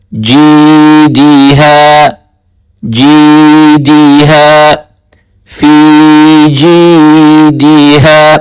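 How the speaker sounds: teacher splitting the word for the pupil; syllable by syllable. Shown as a demonstration. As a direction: split جی and دی ہے into separate drawn-out syllables.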